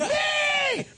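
put-on high, loud little girl's "Me!"; eager shout. Daughter volunteering for the wheelchair, high eager shout. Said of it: "My daughter was like".

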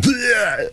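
hammed-up retching Bleh; mock gag. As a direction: fake a dry heave. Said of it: "where Heidi be like".

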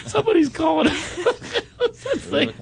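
high, squeaky words through laughter; trying to speak while cracking up; giggling too hard to talk; helpless laughter. Real, not acted. Host still in laughing fit.

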